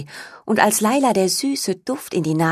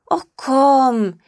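higher, louder pleading whine; begging; 8 semitones higher, 4 dB louder than usual. A narrator's reading. Mo begs Laila to push the swing. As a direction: coax her like a little brother. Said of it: "bettelt Mo".

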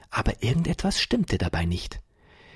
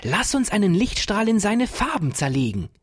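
much higher, bright and eager; thrilled boy urging the professor on; joyful eager plea; 11 semitones higher, 3 dB louder than usual. Acted. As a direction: beg to start the experiment. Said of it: "rief Ferdinand voller Freude".

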